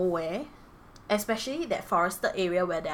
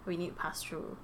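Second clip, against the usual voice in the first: quiet; 3 semitones lower, 11 dB quieter than usual. Retold or imitated. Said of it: "they will say".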